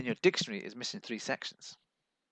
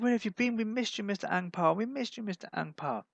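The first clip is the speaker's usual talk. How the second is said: police begging voice; 9 semitones higher than usual. Retold or imitated. Police calling Mister Ang Pao for bribe money, high wheedling voice. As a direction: coax him for money like a greedy officer. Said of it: "They said".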